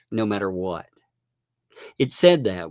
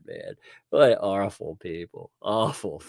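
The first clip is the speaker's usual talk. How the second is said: usual speaking voice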